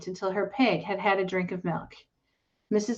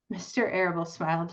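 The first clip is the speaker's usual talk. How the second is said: usual speaking voice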